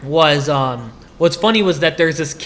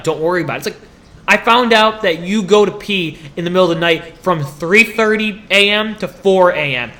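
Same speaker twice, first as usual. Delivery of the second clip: normal talking voice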